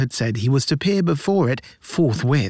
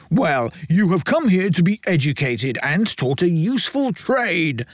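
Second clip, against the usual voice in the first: red-faced gentleman; 4 semitones higher than usual. A narrator's reading. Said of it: "said the red-faced gentleman in the high chair".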